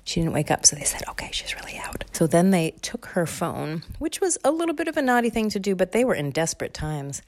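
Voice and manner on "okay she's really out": hushed whispered relief; soft whisper. Acted. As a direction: whisper so she won't wake. Kids whisper.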